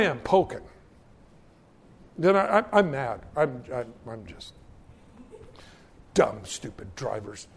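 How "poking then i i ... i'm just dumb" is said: ordinary delivery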